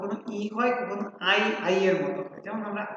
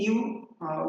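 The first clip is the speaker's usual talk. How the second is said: ordinary talk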